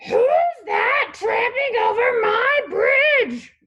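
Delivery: high, loud, slow shout; troll's roar. A narrator's reading. Troll challenges the biggest goat, roaring. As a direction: roar it at full troll volume. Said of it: "roared the troll".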